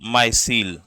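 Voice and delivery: माइसिल with a long "my" first syllable; pronounced माइसिल. Shown as a demonstration. Teacher naming the cellulose bundle for students, pronounced माइसिल. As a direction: say माइसिल as "my-seel", low and measured. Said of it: "इसको बोलते हैं".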